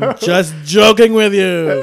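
loud, high, slowly stretched words; booming prank reveal. Retold or imitated. Imitating the officer revealing the joke, gleefully loud. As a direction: shout the reveal, drawing it out.

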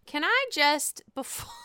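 usual speaking voice

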